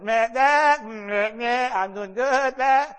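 high, loud bleats around a few words; hammed-up freaked-out babble, straining to sound fine; speechless bleating. Brother telling sister he's fine, mock panicked bleating. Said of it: "I couldn't speak".

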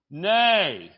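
ordinary delivery

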